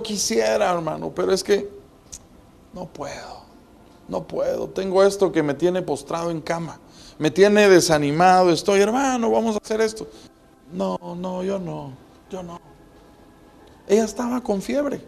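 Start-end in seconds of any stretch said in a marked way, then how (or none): 2.7-4.7 weak refusal. Acted. Voicing the sick one's weak refusal. 10.7-12.6 faint, much softer sickbed no; feeble refusal. Acted. Preacher voicing the feverish woman refusing to get up, faint and much softer.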